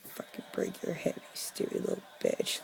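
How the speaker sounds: under-breath whispered insult; muttered curse. Retold or imitated. Whispering an insult at the yelling neighbor. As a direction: hiss it under your breath at him. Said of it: "and I'm like".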